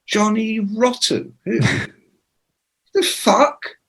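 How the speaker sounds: high, slow disbelieving question; can't believe that's a name; incredulous. Retold or imitated. Asking [name] who the fuck [name] is, high slow disbelief.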